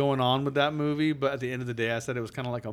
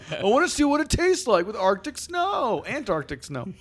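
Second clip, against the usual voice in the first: much higher goofy voice; high silly voice; 10 semitones higher, 4 dB louder than usual. Pretend. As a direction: squeak it like an eager kid. Acting snow cone tourist.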